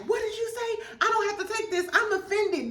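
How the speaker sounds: high-pitched prissy offense; mock offended Meredith. Meredith offended at Mary's table, high and prissy. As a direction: play wounded pride, way up high.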